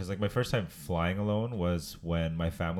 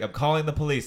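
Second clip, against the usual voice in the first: high, louder mock indignation; retelling the Vine line; 9 semitones higher, 8 dB louder than usual. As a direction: replay the meme with a touch of outrage. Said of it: "she's like".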